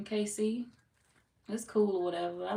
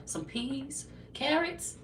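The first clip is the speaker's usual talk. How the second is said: shocked disbelief; 8 semitones higher, much slower than usual. Pretend. Rant climax with pitch jump.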